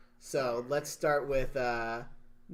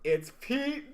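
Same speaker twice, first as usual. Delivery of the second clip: high, loud, drawn-out announcer call; ring announcer; 6 dB louder, slower than usual. Acted. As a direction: belt out a wrestler's entrance introduction. Imitates a wrestler's intro announcement.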